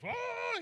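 high, loud mock scream; freaking-out scream. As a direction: imitate a friend shrieking in excitement. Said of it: "he's freaking out".

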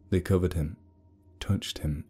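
ordinary delivery

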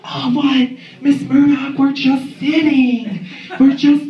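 high, slow innocent caught-out voice; hammed-up high innocent teen; innocent girl caught. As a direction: play the girl caught on the couch. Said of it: "they'll just be like".

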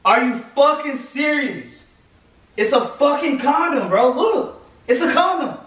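high-pitched, incredulous yelling; real angry outburst. Real, not acted. Boyfriend has found a used condom, furious. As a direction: explode in disbelief, voice shooting up. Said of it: "Why are you going crazy right now?".

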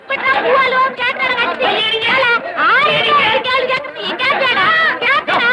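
high-pitched repeated angry demands; furious scolding. Acted. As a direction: berate him while raining blows. Woman beats and berates the man. (கோபமாகக் கத்தித் திட்டுதல்)

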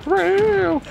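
high mock cat meow; imitated meow. Gamer imitating the wrestler fighter's meow, high and silly. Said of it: "It was like".

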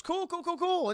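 high nervous chirp. Retold or imitated. Nervous friendly Jackson.

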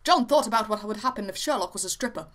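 usual speaking voice

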